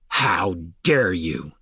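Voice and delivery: slow, seething outrage; outraged. A narrator's reading. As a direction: spit it out in slow fury. Falinar outraged before striking.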